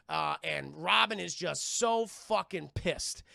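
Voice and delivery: ordinary talk, nothing marked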